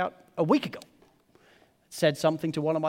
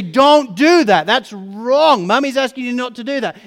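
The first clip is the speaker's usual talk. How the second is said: loud, higher parental scolding; stern scolding; 5 semitones higher, 12 dB louder than usual. Retold or imitated. Parent to kid on a strict day, stern scolding. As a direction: drag out the command forcefully.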